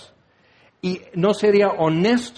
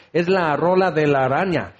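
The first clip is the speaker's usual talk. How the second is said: ordinary talk, nothing marked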